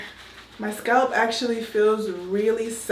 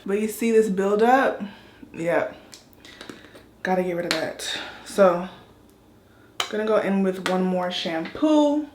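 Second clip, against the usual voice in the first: usual speaking voice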